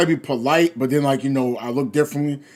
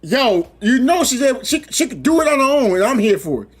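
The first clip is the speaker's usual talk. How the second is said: sister's voice; 6 semitones higher than usual. Pretend. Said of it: "I can see my sister like".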